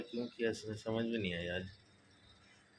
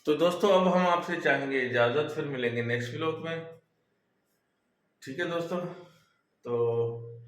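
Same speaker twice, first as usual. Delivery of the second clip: high, loud cheerful farewell to viewers; loud upbeat sign-off; 5 semitones higher, 4 dB louder than usual. Real, not acted. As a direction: call out the goodbye with energy. (दर्शकों को ऊँची, ज़ोरदार आवाज़ में अलविदा)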